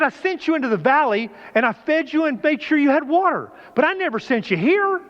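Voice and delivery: high firm reproach; God reproving. Acted. God reproves Elijah for running, high reproach. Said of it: "He said".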